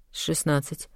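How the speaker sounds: plain reading voice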